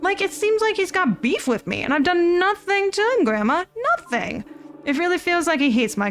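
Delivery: high-pitched, aggrieved complaint; worked-up, pleading grievance; upset venting. Acted. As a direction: protest your innocence to a trusted listener. Upset grandson complains about supervisor.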